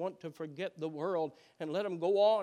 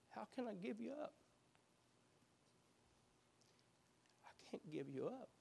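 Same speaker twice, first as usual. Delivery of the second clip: soft, hushed pleading as God; tender plea; 4 semitones higher, 13 dB quieter than usual. Acted. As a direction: plead gently, almost a whisper.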